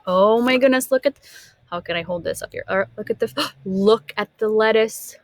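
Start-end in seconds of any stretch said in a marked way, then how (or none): none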